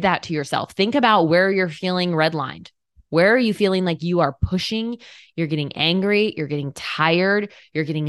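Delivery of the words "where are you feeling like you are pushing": ordinary talk, nothing marked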